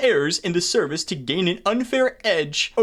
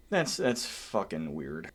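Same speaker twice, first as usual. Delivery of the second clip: slow, much lower and softer mutter; disgusted mutter; 11 semitones lower, 11 dB quieter, slower than usual. Real, not acted. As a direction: mumble it in disbelief.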